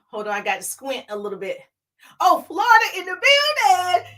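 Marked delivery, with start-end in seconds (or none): 2.2-4.1 high, loud hype call; excited roll-call holler; shout-out. Real, not acted. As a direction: hail the arrivals like a hype host. Host hails viewers joining live.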